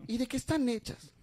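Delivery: effeminate customer. Retold or imitated. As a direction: swoon over the boots. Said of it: "medias afeminadas".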